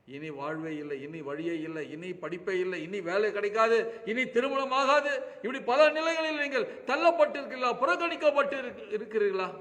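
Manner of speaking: rising from plain to a high, loud preaching cry; building preaching. Acted. Preacher questioning despairing listeners, building to loud preaching.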